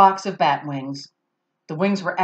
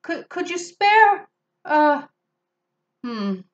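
timid beggar voice; 7 semitones higher than usual. Acted. Character begging, far voice.

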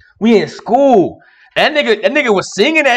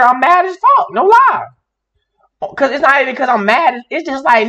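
ordinary talk

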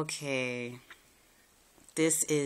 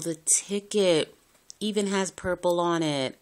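ordinary talk, nothing marked

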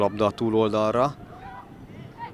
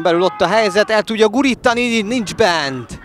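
high, loud rising call of a chance; building yell that breaks off at the miss; excited sports commentary; 10 semitones higher, 9 dB louder than usual. Real, not acted. Big chance missed in the box.

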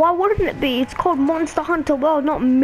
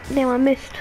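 ordinary talk, nothing marked

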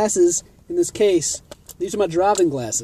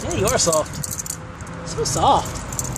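normal talking voice

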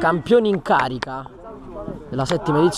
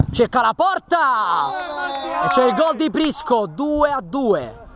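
rising shout into the goal; excited goal call; 6 semitones higher than usual. Real, not acted. Goal called live, two all.

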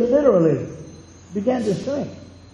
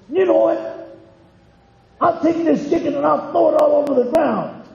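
mock angry threat; 7 semitones higher than usual. Husband threatening to throw the chicken, hammed-up anger. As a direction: huff like a fed-up husband. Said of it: "I got mad too".

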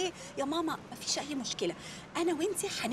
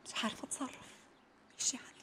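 much softer whispered plea; 10 semitones lower, 15 dB quieter, faster than usual. Acted. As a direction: whisper a careful request. Pleading softly not too harshly.

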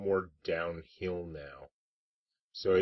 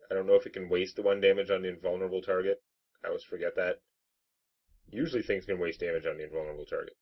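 ordinary talk

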